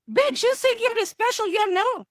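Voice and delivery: high, punchy callout; sassy callout. Retold or imitated. Therapist telling an offended client they're not special, sassy and high. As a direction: knock the client down a peg.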